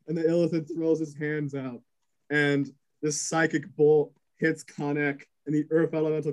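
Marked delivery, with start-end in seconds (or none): none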